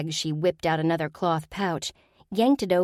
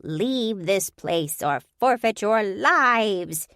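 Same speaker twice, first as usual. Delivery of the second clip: menacing ghoul; 4 semitones higher, 4 dB louder, much slower than usual. Acted. Ghoul warns intruders.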